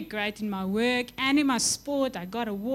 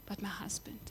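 soft whisper of God's words; 3 semitones lower, 15 dB quieter than usual. Acted. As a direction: breathe it like a secret.

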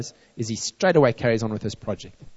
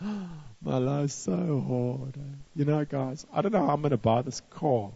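mock whining friend; 6 dB quieter than usual. Friend complaining about buying a car, mock whiny. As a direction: whine it like a friend throwing a pity party, much softer. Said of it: "people will come to you and like".

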